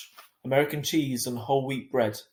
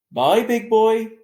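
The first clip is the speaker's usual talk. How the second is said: fond goodbye; 11 semitones higher, 12 dB louder, much slower than usual. A narrator's reading. Dad to young son.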